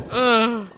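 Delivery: loud, drawn-out "ugh"; exasperated groan. Real, not acted. Fan fed up with the bad story, exasperated groan. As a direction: groan out your frustration loudly.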